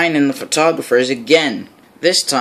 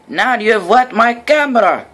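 annoyed; 8 semitones higher, 3 dB louder than usual. A narrator's reading. Photographer annoyed at Thomas.

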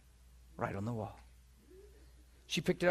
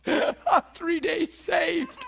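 slow, laughing through the words; amused, slow laughing delivery; 8 semitones higher, much slower than usual. Real, not acted. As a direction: chuckle out the punchline. Laughing at the irony.

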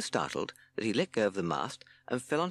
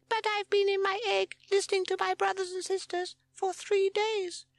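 very high, loud squeaky voice; proud chick; 5 dB louder than usual. A narrator's reading. Bundle of fluff answering Sid, proud squeak. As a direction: pipe it up proudly. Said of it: "puffed itself up proudly".

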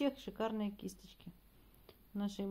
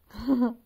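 loud happy laugh; delighted laugh; 5 semitones higher, 14 dB louder than usual. Real, not acted. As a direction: laugh out with pleasure. Laughing at the cat. (радостный смех)

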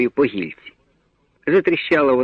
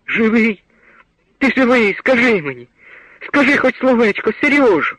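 sobbing plea; 11 semitones higher than usual. A narrator's reading. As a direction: beg him to answer, voice breaking high. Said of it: "Ридаючи, кричала мати". (мати в розпачі благає сина відповісти)

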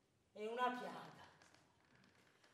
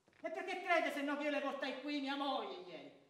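normal talking voice

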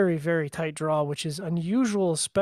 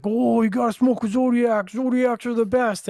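higher, louder parody of gushing fans; mock hype; 5 semitones higher, 5 dB louder than usual. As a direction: imitate everyone raving, pitched up and pushed. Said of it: "you know, everyone's like".

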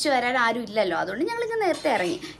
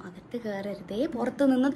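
calm gentle request; 3 semitones lower, 6 dB quieter than usual. Retold or imitated. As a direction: say it softly and kindly, no hurry. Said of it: "Potti വളരെ സമാധാനത്തിൽ പറയുന്നു".

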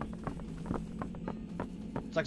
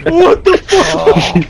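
shocked yell; 9 semitones higher, 18 dB louder, much slower than usual. Real, not acted. Shocked yelling, slow.

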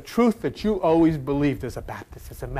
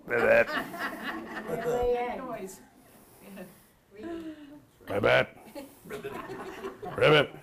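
frog croak; 5 semitones higher, 8 dB quieter than usual. Pretend. Preacher croaking like a frog to the congregation. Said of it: "they croak".

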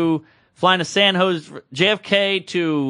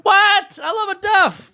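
mock indignation; 12 semitones higher, slower than usual. Comic defending a beloved duffel, mock indignation. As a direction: squawk back in mock outrage. Mock outrage at being teased.

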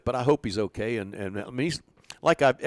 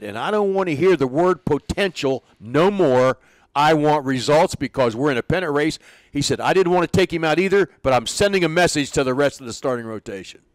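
louder, emphatic retold speech; firm manager declaration; 5 semitones higher, 7 dB louder than usual. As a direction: lay down the law on results.

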